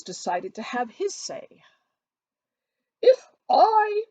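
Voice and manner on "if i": high, loud voice pitched to fill the room; booming Mr Collins. A narrator's reading. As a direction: make the whole room hear you. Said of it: "speaking in a voice that the whole room could hear".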